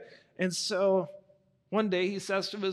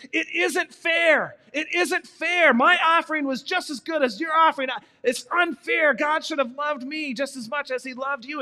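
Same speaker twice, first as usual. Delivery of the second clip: anguished shouting, first lines dragged out; furious shout; 7 semitones higher, 6 dB louder than usual. Acted. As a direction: yell it out like a jealous older brother losing it. Cain snaps, pins his brother.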